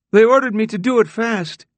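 high, loud, squeaky little man; high-pitched mousy excuse. A narrator's reading. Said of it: "A mousy voice, too".

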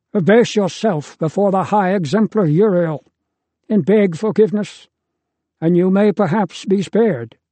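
a father ordering his son to yield, voice lifted. A narrator's reading. Deucalion commands son to submit.

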